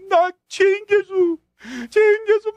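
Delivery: high, loud, slow wailing confession with sobs; crying repentance. Retold or imitated. Healed man repenting at the sea, crying loudly and slowly. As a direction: wail out the confession in tears. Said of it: "막 우는 거야".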